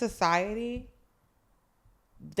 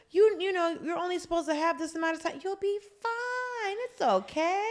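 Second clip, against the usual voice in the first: mimicking the wife; 8 semitones higher, 4 dB quieter than usual. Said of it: "the patient's wife is like".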